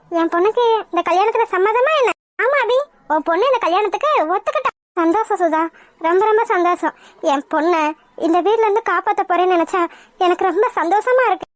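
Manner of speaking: normal talking voice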